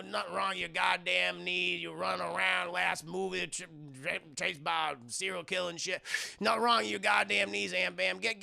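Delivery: ordinary delivery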